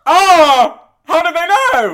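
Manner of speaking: high, loud gasp of amazement; loud high amazed exclamation; amazed shriek. Real, not acted. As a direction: squeal with delighted surprise. Amazed on opening card.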